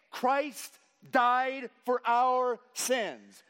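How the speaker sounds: slow, loud, high preacher's cry; preachy voice. Shown as a demonstration. Pastor hamming it up for the congregation in a preachy voice. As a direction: belt it out like a revival preacher. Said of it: "very preachy voice".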